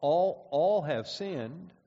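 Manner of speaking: all repeated very slowly; stress on all. Shown as a demonstration. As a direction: drag out all, all to convict. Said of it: "it's a convicting thought".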